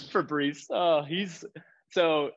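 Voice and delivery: high, amused through laughter; laughing. Real, not acted. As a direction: laugh as you say the name. Laughing about a space cadet.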